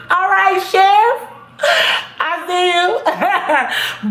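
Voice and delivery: high, laughing catcall to the sheriff; playful whistle-at-you tone; flirty teasing. Real, not acted. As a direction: tease him like you're checking him out.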